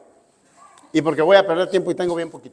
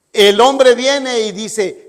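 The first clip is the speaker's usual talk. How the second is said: ordinary delivery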